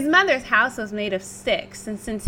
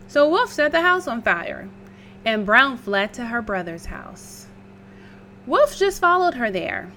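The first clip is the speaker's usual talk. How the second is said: ordinary delivery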